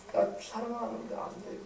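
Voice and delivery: very soft, subdued admission; humble confession. Acted. Peter admitting his failure to Jesus, humble. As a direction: own up in a small hushed voice.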